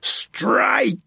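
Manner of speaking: high, drawn-out battle cry; strained, high-pitched command; bellow. A narrator's reading. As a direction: bellow the order to attack, pitch leaping up. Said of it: "he bellowed".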